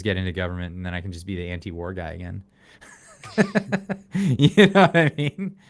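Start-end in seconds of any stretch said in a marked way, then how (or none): 3.4-5.6 slow, laughing through the words. Real, not acted. Laughing as he jokes to his podcast co-host. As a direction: chuckle it out as you ask.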